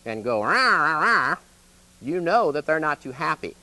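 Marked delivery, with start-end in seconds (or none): 0.4-1.3 mock snarling rah, louder. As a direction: growl it out like an unhappy friend. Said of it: "if they snarl at you".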